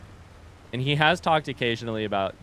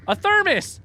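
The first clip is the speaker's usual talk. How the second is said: high, thrilled exclamation; excited; 7 dB louder, slower than usual. Real, not acted. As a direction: squeal with delight at the find. Finds a thermos, excited.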